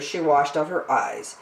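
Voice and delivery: plain reading voice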